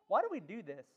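ordinary talk, nothing marked

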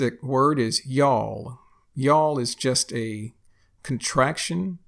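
normal talking voice

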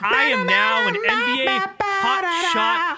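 very high, slow, drawn-out hype; hyped announcement. Acted. Telling his brother he's now an NBA Hot Shot fan, very high and slow. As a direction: announce it like a stadium introduction. Hyping his crypto obsession.

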